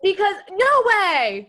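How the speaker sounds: loud, high, drawn-out disbelief; real astonishment. Real, not acted. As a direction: burst out in delighted surprise at the coincidence. Discovers listener had her mom as teacher.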